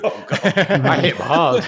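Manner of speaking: said through laughter, loud and high; laughing. Real, not acted. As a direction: laugh it out in disbelief. Laughing at own punch.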